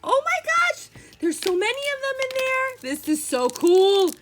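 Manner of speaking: real giddy delight, voice way up; excited squealing. Real, not acted. Opening a toy pack for viewers, excited squealing. Gets chocolate snack packs toy.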